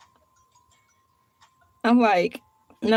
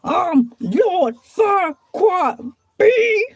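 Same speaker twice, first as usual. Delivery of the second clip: drawn out slowly and raised like a grand title; grand self-announcement; 5 semitones higher, much slower than usual. Pretend. Vlogger hyping a villain name, slow grand announcement. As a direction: proclaim yourself royalty, slowly. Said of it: "put some stank on Lord Farquaad".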